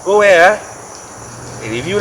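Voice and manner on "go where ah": high, loud hearty hello; loud phone greeting. Acted. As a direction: greet a buddy loudly on the phone.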